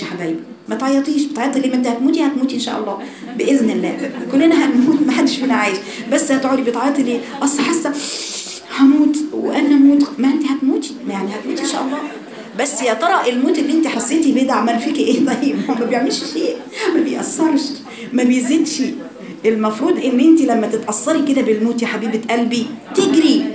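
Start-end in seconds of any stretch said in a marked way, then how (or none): 0.7-7.2 lively preaching. Acted. Teasing exhortation to the girls. 7.4-10.1 mock whiny girl. Voicing a whiny crying girl. 10.2-23.4 lively preaching. Acted. Teasing exhortation to the girls.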